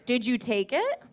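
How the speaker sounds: ordinary delivery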